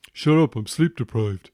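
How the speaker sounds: put-on grumbling teen brushing off his father; sulky teenage son. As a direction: play the moody teen who's fed up with his dad. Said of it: "Why are you talking like that?".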